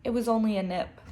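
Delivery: usual speaking voice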